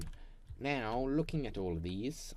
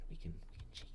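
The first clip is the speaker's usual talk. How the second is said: whispered aside; 13 dB quieter, faster than usual. Real, not acted. Conspiratorial aside.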